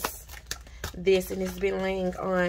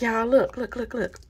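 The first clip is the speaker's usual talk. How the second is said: usual speaking voice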